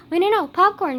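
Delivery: loud, high mock-panicked protest; retold alarm. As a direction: act out sudden panic. Retells alarm at leaving her turtle.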